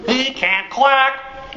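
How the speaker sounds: slow, high sing-song taunt; teasing. Acted. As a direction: drag it out to mock him. Ducklings tease the moose.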